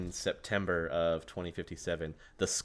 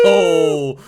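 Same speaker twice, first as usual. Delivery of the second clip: a sudden high, loud exclamation; excited oh; 19 dB louder than usual. Real, not acted. Player cheering along with the table, excited shout. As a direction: burst out in delight.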